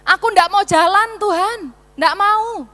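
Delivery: loud, high pleading refusal; desperate plea. Acted. Moses begging God to lead them: loud desperate plea. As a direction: beg God not to leave.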